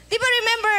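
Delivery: high, drawn-out mock welcome. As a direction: sing it out like greeting a guest. (pabirong masiglang pagtanggap)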